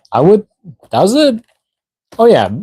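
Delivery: sudden high surprise; surprised. Real, not acted. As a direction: caught off guard, pitch jumps. Reacting to a chat comment.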